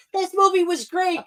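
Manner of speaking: high-pitched little-kid impression; mock child voice, high; imitating the son. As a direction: pipe it up like a pretend son. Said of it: "Holding my son, who's like".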